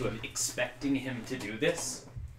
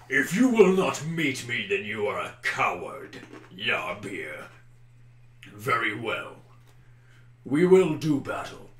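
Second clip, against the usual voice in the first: orc leader's taunt; 4 dB louder than usual. Acted. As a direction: taunt him as a rival warlord. Orc leader calls Arden a coward.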